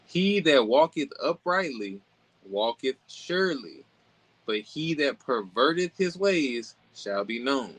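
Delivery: slow, measured Bible reading; deliberate proclamation of a proverb; scripture reading. Acted. Reads scripture aloud.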